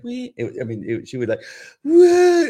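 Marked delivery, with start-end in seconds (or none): 0.0-0.3 small, high, soft mock cry; a tiny imitation whimper; imitated little whimper. Imitating a small child barely crying, soft mock whimper. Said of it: "It wasn't just like". 1.8-2.5 loud, high mock wail; imitated hysterical bawling. Imitating a small child bawling at a voice, hysterical mock wail. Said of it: "bawl, like, hysterically".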